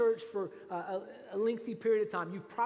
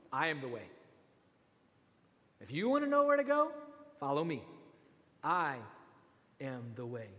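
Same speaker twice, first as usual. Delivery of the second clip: lower, firm answer; calm Jesus; 5 semitones lower than usual. Acted. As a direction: settle the question, lower. Voicing Jesus answering, low and firm.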